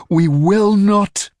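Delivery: high, loud and drawn out; defiant shout. Acted. Duet shouting her refusal at the infernal. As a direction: yell it slowly in defiance.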